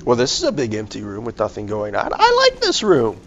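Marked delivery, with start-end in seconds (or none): 2.1-3.2 high sing-song. Pretend. Alone in quiet empty room.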